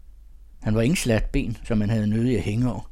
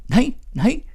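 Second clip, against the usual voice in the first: much higher put-on girl's voice, loud; a higher, louder girlish protest; shrill flustered refusal; 7 dB louder than usual. A narrator's reading. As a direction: squeal the no's in a girl's voice. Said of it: "Mette svalrede op".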